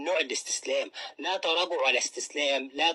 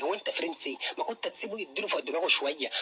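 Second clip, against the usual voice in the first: ordinary talk, nothing marked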